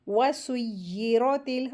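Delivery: वसुय्यिरतिल with double y and rounded ro; heavy ra. Shown as a demonstration. Teacher models heavy ra, slow rounded ro in वसुय्यिरतिल. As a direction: thicken the r of वसुय्यिरतिल toward ro. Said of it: "रा को heavy करके पढ़ेंगे".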